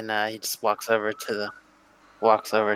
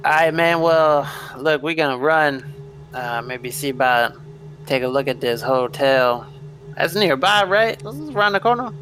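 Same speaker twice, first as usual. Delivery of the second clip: higher-pitched, easygoing street voice; Lightning Red, casual; 4 semitones higher than usual. Acted. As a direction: wrap up and get ready to head out, rising into a question.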